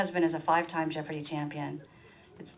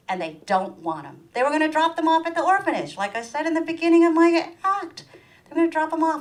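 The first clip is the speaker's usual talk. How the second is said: ordinary talk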